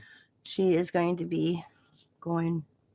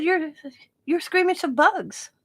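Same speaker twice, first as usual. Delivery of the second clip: higher teasing voice; teasing reminder; 9 semitones higher than usual. Retold or imitated. Grandma to granddaughter about bugs, higher teasing. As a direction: remind her, playfully doubtful. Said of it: "I said".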